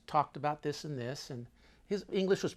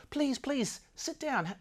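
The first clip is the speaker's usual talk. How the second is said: put-on pleading, higher Russian-accented English; imitated foreign accent; 6 semitones higher than usual. Birthday man begs a guest to drink, imitated Russian accent. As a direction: coax in a high-pitched Russian accent. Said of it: "speaking in English with an accent".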